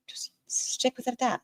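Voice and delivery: breathy unvoiced whisper, soft; whispered aside. Real, not acted. Host whispers a quick aside to chat, whispering.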